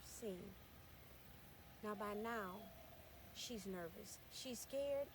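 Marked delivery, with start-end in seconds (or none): none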